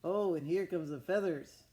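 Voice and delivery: usual speaking voice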